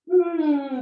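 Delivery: loud sighing hum; dramatic sigh. Shown as a demonstration. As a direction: let out a big audible sigh. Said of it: "big sigh".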